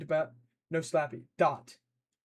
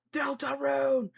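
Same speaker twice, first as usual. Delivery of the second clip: shouting the title; 10 semitones higher, slower than usual. Real, not acted. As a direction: belt it out like a cheer. Streamer shouts game title.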